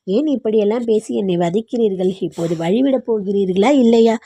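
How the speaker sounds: plain reading voice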